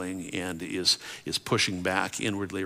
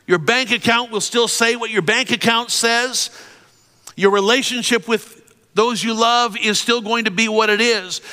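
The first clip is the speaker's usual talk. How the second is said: loud, high, driving sermon climax; full-force preaching; 12 semitones higher, 9 dB louder than usual. Acted.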